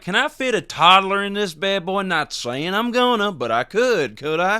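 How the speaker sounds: high, put-on dopey shopper voice; goofy customer asking a clerk; mocking a shopper. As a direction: ham up a clueless buyer. Mock customer quote, high.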